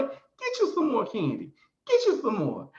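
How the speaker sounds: high singsong imitation of urging; coaxing a child. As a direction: coax a little kid, falling at each phrase end. Man coaxes supposed child again.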